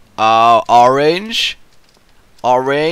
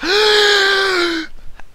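high dramatic gasp after a breathless ramble; comic gasp to catch breath; huge gasp for air. Pretend. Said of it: "I really need to take a breath".

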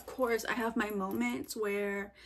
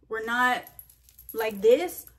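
bumpy, loud; 8 dB louder, slower than usual. Shown as a demonstration. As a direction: punch this like a rough ride, louder. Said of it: "We're smooth sailing".

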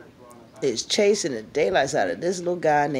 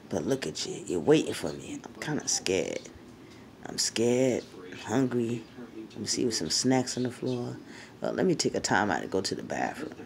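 much lower, softer worn-out puppy voice; tired dog voice; 5 semitones lower, 8 dB quieter than usual. Pretend. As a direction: speak as the dog who is tired of losing the game.

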